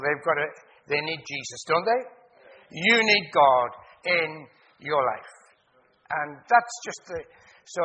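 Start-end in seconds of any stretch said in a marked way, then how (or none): 2.7-5.3 slow, loud, every word hammered; preacher presses the crowd, slow and loud; emphatic appeal. Real, not acted. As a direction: preach it straight at them. Preacher drives message home.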